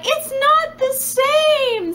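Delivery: high, very slow whiny wail; hammed-up tearful whine; mock bawling. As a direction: drag it out like a sobbing child. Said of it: "And I'm bawling".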